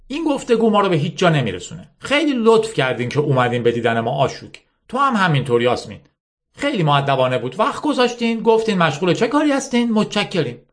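a lightly voiced older woman; polite dismissal. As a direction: wrap up the meeting politely.

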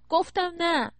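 loud, high outburst; shouted, exasperated refusal; angry shout. A narrator's reading. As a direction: snap back at a pushy stranger. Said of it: "داد زد".

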